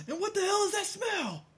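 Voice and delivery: very high, squeaky disgusted cry; mock outrage. Friend quoting the movie line, high squeaky disgust. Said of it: "that shit from fucking Independence Day".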